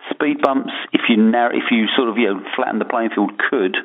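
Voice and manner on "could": COULD set apart, followed by a pause; stress on COULD. Real, not acted. Analyst hedging on market quality, stressing "could". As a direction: lean on "could" as a caveat, then pause. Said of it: "stress the word could".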